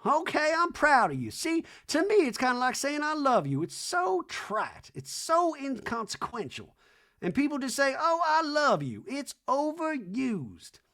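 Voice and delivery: high, slow hammed-up Reba; lofty slow mock-mom rant. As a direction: drone it like a grand old dame. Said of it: "Reba in scene".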